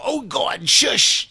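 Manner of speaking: high-pitched imitated alarm; mock panicked hushing. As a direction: hush someone in exaggerated embarrassment. Acted quote, hushing someone in alarm.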